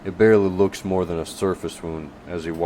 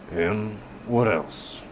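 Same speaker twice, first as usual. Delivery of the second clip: slow gruff prompt; 3 semitones higher, much slower than usual. Acted. Casper prompting slowly.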